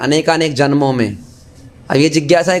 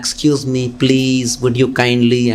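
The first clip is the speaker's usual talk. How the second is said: mock sophisticated; 6 semitones lower, much slower than usual. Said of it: "sophisticated तरीके से बोलना".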